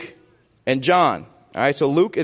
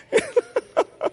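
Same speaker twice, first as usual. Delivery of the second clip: high laugh. Real, not acted. Laughing at wives' raised hands.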